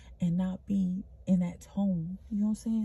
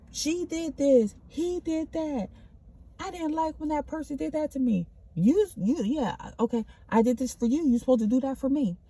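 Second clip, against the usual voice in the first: imitating a whiny blamer, pitched way up; mock petty blame; 7 semitones higher, 3 dB louder than usual. Mocking her old self who blamed everyone, high and whiny.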